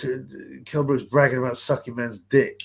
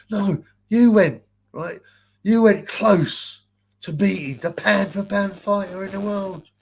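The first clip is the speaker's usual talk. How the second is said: slow, higher, louder gushing flatterer; mocking Spencer Oliver; 7 semitones higher, 5 dB louder than usual. Mocking a pundit praising the beaten fighter, slow gushing impression. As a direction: drag it out like a fawning pundit. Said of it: "Spencer Oliver goes".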